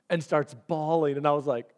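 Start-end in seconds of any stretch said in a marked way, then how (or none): none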